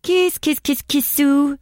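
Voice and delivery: "Kiss, kiss" squeaked high; Estonian cat call. Shown as a demonstration. Host showing how Estonians call cats, high. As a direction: coax a cat Estonian style. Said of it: "we call cats".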